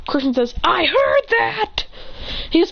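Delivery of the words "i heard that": high, stretched-out acted cry; angry retort. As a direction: call out indignantly, drawing each word. Acted quarrel.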